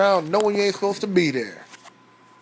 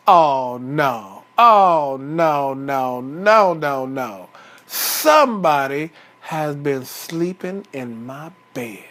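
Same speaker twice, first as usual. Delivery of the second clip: low, drawn-out groaning protest, fading at the end; dismayed Papa Bear; 4 semitones lower, slower than usual. Acted. Papa Bear finds his bed slept in.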